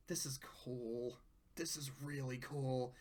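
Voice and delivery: soft, hushed admiration; quiet awe. Real, not acted. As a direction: murmur in awe.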